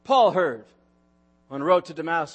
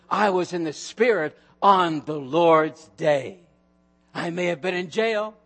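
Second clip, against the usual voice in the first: hushed reverent quote; 7 semitones lower than usual. Acted. John quoted in the Spirit.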